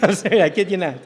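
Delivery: ordinary delivery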